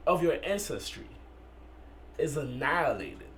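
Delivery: slow spoken-word delivery with long, weighty pauses; poem recitation. Acted. Poet addressing a woman about the man holding her, spoken-word recitation. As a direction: recite it phrase by phrase, letting each image hang.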